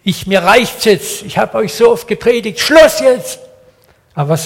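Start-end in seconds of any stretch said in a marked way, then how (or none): 0.0-2.6 much higher, louder exasperated outburst; fed-up angry God. Retold or imitated. Preacher voicing a fed-up God at his people, angry shout. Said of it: "Jetzt könnte Gott sagen". 2.6-3.4 much higher, louder final cry of Schluss; furious shout. Retold or imitated. Preacher voicing God who has had enough, full shout. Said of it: "Jetzt könnte Gott sagen".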